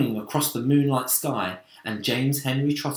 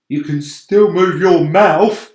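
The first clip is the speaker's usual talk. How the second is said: slow, louder, sardonic jab; dry earthworm; 5 semitones higher, 11 dB louder than usual. A narrator's reading. Earthworm mocks the centipede's complaining, slow dry jab. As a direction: needle the noisy centipede.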